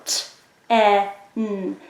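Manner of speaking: "t, e, n" as isolated phonemes with gaps; "t, e, n" segmented, each sound on its own. Shown as a demonstration. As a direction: sound out "t, e, n" slowly: t, short eh, nnn. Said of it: "The tricky part comes in the middle".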